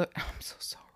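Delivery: embarrassed whispered aside; whispering. Real, not acted. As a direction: whisper an apology to listeners. Embarrassed aside after burping. (verlegen geflüstert)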